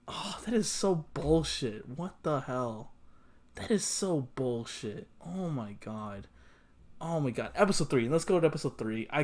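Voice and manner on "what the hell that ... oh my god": usual speaking voice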